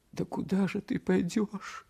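high, thin-pitched anxious question; frightened mother. A narrator's reading. Frightened mother questions departing son.

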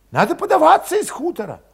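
put-on high, agitated young cossack voice; Valet voiced high. A narrator's reading. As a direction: push it up high and restless.

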